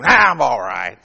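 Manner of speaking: low, loud grumpy mumble; sour reply. Retold or imitated. Preacher voicing a sour neighbour, low grumpy reply. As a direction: answer like a sourpuss who never smiles. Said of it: "Always say".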